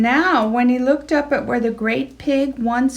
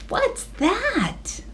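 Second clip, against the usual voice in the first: curious piggy voice, raised; a touch of squeal; 11 semitones higher, 5 dB quieter than usual. Acted. Pig Henry spots stars, a touch of squeal. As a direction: wonder at the sky. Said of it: "he squealed".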